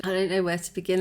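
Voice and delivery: ordinary talk, nothing marked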